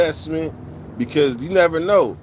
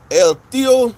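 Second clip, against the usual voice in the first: high, loud call to a viewer; 9 semitones higher, 6 dB louder than usual. Real, not acted. Calling out to El Tio in the live chat, loud and high. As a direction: sing out his name to grab his attention.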